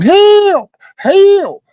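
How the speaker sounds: loud, very high mock scream; imitated scream for help. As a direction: mimic a kid yelling for help at the top of the voice. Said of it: "So he's standing there looking".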